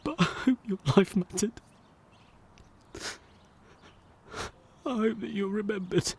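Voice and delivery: choked-up, climbing high on the hope; voice rising with emotion. Acted. Eulogy for a stranger, high and near tears. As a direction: let the feeling push the voice up.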